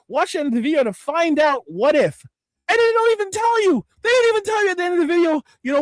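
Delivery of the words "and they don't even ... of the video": raised, fast exasperated rant; real frustration pouring out at once; frustrated rant. Real, not acted. Streamer ranting to chat about clickbait videos, exasperated and fast. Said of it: "You can hear the passion in my voice".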